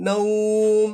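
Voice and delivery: "নাউম" with a long au, closing on m; sustained recitation-style "নাউম"; one-alif stretch. Shown as a demonstration. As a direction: hold the au of "নাউম", then shut on m. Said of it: "একালিফ টেনে পড়বো".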